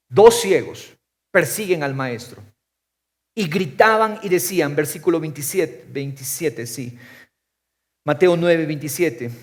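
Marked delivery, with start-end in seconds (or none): none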